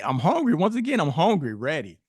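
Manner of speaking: high, loud retold pep talk to himself; psyched up. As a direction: hype yourself up, hammering out the hunger. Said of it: "happy dance".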